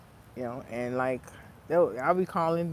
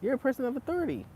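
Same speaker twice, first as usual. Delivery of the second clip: put-on childlike indignant squeak, higher pitch; little kid scolding an adult in charge; outraged kid; 8 semitones higher than usual. As a kid scolding authority, mildly high indignant voice. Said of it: "I'm like".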